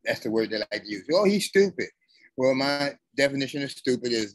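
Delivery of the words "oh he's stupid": higher-pitched mocking quote; mock dismissive voice, raised pitch; mimicked put-down. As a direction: imitate someone dismissing him, pitched well up.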